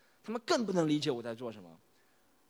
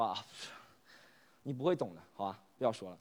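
lower, softer, resigned brush-off; dejected reply; 9 semitones lower, 8 dB quieter than usual. Acted. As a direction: sigh it out, not wanting to explain. (低落敷衍地回答)

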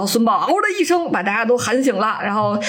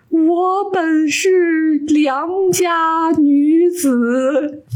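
wailing ghost; 8 semitones higher, much slower than usual. Acted. Ghost wails her grievance.

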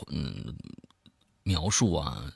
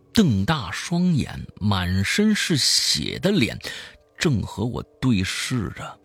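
slow, weighted reveal building to a forceful burst; emphatic horror-story narration; dramatic storytelling; 3 semitones higher, 6 dB louder, slower than usual. A narrator's reading. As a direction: draw out the grisly details, then drive hard. Tells a horror story aloud.

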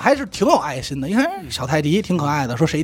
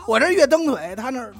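ordinary delivery

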